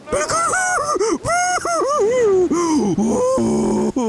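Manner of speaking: long, high, cackling laugh. Retold or imitated. Friend acts out the woman's reaction with a high, long laugh.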